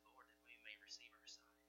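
soft, low whispered plea. Acted. Blind men whispering their plea to Jesus. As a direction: breathe the plea with deep reverence.

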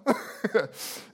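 short chuckle at own joke; amused little laugh. Real, not acted. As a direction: laugh lightly after the skit.

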